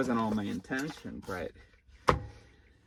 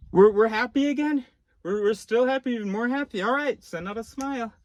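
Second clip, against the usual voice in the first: high-pitched voice of the brain cheering; happy inner voice; 11 semitones higher, 5 dB louder than usual. Acted. Inner voice telling the others to send out a smile, happy and high. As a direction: pitch it way up, like a delighted little announcement. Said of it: "there's a voice that goes".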